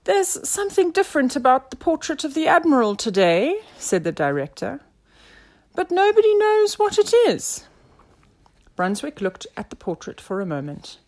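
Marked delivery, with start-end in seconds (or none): 0.1-3.6 higher-pitched, fretful-sounding director; director, pitched well above narration; director's voice. A narrator's reading. Director reporting the mystery. 5.8-7.7 very high, loud director voice; high-pitched wail of bafflement; baffled director. A narrator's reading.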